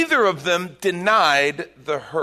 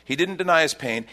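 ordinary talk, nothing marked

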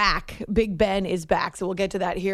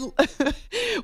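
nervous laugh; 7 semitones higher than usual. Real, not acted. Laughing at her own fright.

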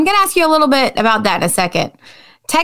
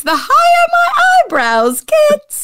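laughing, high; 14 semitones higher than usual. Real, not acted. Host joking about his own rising voice, laughing high. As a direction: voice climbing high through a laugh. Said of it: "The higher my voice gets".

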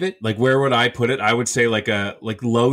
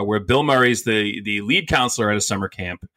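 usual speaking voice

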